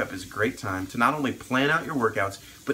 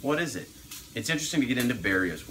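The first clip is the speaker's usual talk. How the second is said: ordinary talk, nothing marked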